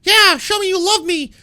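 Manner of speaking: very high, loud hammed-up tantrum of a demanding husband; mock screaming husband. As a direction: scream it like a spoiled man-child barking orders. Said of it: "is like".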